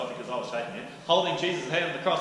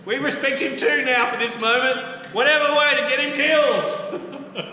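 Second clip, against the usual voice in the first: much higher, loud, mocking sing-song; sarcastic wheedling; 11 semitones higher, 9 dB louder than usual. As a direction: feign loyalty to Caesar with a sneer. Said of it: "So they're going".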